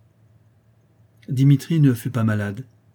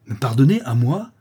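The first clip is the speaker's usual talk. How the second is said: loud, incredulous angry question; pale with rage; 3 semitones higher, 6 dB louder than usual. Acted. Young count refusing Justine's pardon, enraged. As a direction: throw it back in outraged disbelief. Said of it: "dit l'enfant blême de rage".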